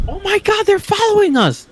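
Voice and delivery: high-pitched imitation of a panicked mom; mock scream. Mom panicking at the boys following them, mock scream. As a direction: mimic a scream without raising the volume. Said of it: "screaming is not going to help us".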